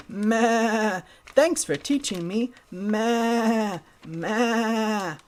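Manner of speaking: high piglet voice with long bleats; merry pig. Acted. Pig thanks Goat and heads off, merry. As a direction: bleat happily as a grateful piglet. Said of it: "Pig merrily went along his way".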